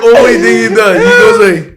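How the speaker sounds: high, loud laughing through words. Real, not acted. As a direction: laugh it out in disbelief. Laughing while recounting friend.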